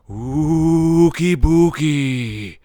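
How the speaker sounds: long, drawn-out loud ghostly call; imitated haunting moan, stretched right out; spooky ghost noises. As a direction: wail it slowly like a cartoon ghost. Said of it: "starts making, like, ghost noises".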